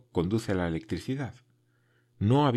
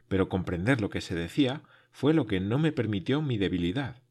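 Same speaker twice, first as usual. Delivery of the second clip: plain reading voice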